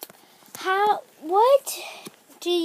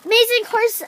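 excited higher play-by-play voice; sports commentator; 6 semitones higher, 4 dB louder than usual. Acted. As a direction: call the race like an announcer. Commentates the horse show.

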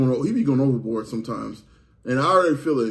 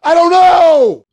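long drawn-out scream, high and loud; 14 dB louder, much slower than usual. Real, not acted. Forced to stay silent, he screams the words long and loud. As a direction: scream it out slowly at the top of your voice.

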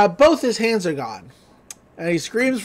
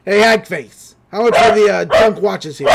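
brash, loud huckster call; loud mock greeting; 6 dB louder than usual. Stan hailing the witch at her stall, loud and brash. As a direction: bark it across the stall. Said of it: "she's like".

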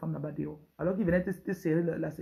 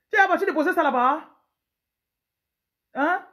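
loud, high demanding husband; bossy husband; 10 semitones higher, 6 dB louder than usual. Acted. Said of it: "Un pauvre autoritaire". (mari autoritaire qui crie)

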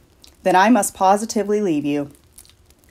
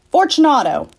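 high, louder call into the dark; calling aloud; 7 semitones higher, 4 dB louder than usual. Acted. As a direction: call his name into the niche. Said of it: "I called aloud".

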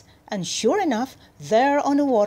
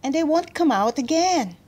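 put-on high little-girl plea; pleading; 6 semitones higher than usual. A narrator's reading. Girl explaining to the cat, pleading high. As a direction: plead in a raised voice.

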